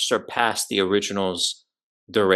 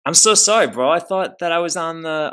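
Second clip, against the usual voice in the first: apologetic; 8 semitones higher, 5 dB louder than usual. Real, not acted. Apologizing for the call.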